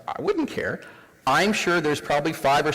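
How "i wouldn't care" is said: much higher, light mock woman's voice; woman in audience. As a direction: airily toss it off as a shrugging audience member. Voicing a woman in the room.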